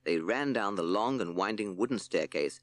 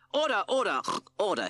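loud, higher cry of order; cried out; 13 semitones higher, 5 dB louder than usual. Acted. Justice Pig calls the noisy court to order, loud and high. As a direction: bang it out like a cross judge. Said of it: "he cried".

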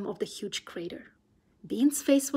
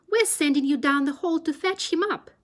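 loud higher order; 6 semitones higher, 6 dB louder than usual. Acted. Bean orders Buns down the hole, loud order. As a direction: tell him loud where he's going. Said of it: "said Bean".

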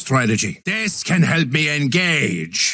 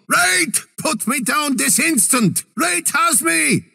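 high shouted protest; high panicked shout while being dragged off; alarmed shout; 8 semitones higher than usual. Acted. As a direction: yell for help, high and urgent. Being carried off by enemy.

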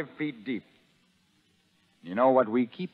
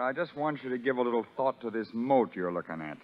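normal talking voice

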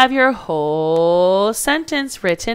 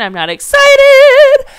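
much higher and much louder, slower; mock excited; 11 dB louder, slower than usual. Shown as a demonstration. Teacher shows the excited voice the sentence doesn't need, mock thrilled. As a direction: burst out as if thrilled. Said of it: "It's also a regular statement".

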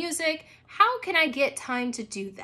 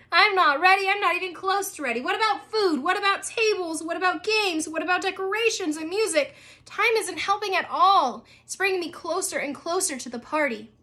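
put-on higher, frantic young girl fretting; panicky Abby; 6 semitones higher, 3 dB louder than usual. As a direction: rush through her worries like a frazzled kid. Abby's panicked journal.

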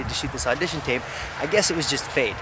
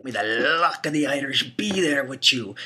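put-on a self-admitted clumsy Irish accent on an old saying; lilting Irish brogue, half-hearted; bad Irish accent; 3 dB louder than usual. Irish accent, playfully botched for the camera. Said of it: "my Irish accent is kinda crap".

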